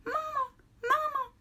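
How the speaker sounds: very high whimpering chick cry; crying gosling peep. A narrator's reading. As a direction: cry for mama like a tiny chick. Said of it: "peeped the gosling".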